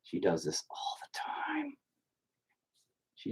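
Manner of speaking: hushed aside, barely voiced; whisper. Real, not acted. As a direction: whisper so she won't hear. Aside about the kid nearby.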